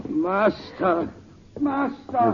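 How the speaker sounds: high, grief-struck calls; anguished cry. Acted. Servant finding his master's body, anguished cries.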